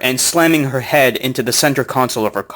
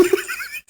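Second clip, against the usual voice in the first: squeaky high laugh, breathy and short; laughing at the absurd reply; high burst of laughter; 10 dB quieter than usual. Real, not acted. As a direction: let a sudden high laugh escape. Laughing at his messages.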